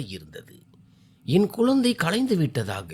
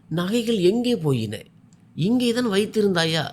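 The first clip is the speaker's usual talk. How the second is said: husband asking in a worried rush, pitched higher; anxious husband; 6 semitones higher, 4 dB louder than usual. A narrator's reading. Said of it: "கணவர் பதற்றத்துடன் கேட்டார்".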